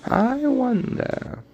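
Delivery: very high, slow wondering voice; wizard impression. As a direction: stretch it high and slow, dreamy. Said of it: "like that one guy from Harry Potter".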